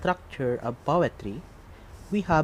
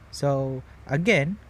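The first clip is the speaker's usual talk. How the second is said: ordinary talk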